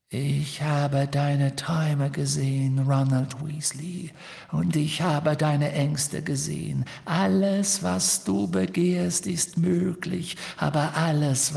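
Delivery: slow, high, whispery temptation; eerie locket voice. Acted. Locket voice tempting Ron with his fears, slow whispery. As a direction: seduce him with slow menace.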